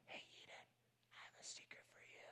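very soft, voiceless whisper; modelling a hushed secret voice. Retold or imitated. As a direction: whisper a secret to a small child. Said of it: "And I whisper and I try to model".

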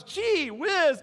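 a high, lightly voiced little skunk; Flower voice. Preacher voicing Flower reacting to the owl. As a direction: chirp it like a shy cartoon skunk. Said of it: "Flower responds".

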